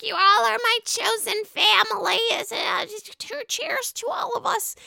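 high-pitched mock toast voice; Teresa. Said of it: "Teresa does a toast for everyone".

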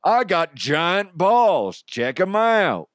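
high, loud, drawn-out comic cowboy; Cowboy DeHuff. Shown as a demonstration. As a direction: stretch it out like a hollering cartoon cowboy. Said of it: "I think it's Cowboy DeHuff".

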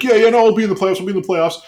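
put-on eager assurance; mock reassurance. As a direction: rattle off a high-pitched mock promise. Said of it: "he has to be like".

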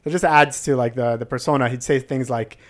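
ordinary talk

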